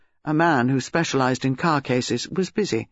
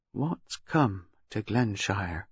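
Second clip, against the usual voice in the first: low, soft, slow musing; slow, low question to oneself; said to himself; 7 semitones lower, 6 dB quieter, much slower than usual. A narrator's reading. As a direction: ponder it privately, half under the voice. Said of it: "He said to himself".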